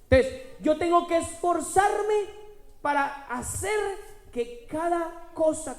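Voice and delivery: loud, high, building preacher's cry; preaching at full force. Acted. Pastor proclaiming to the congregation, loud and rising. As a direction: proclaim the power within, rising.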